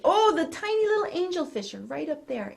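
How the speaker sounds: ordinary delivery, nothing marked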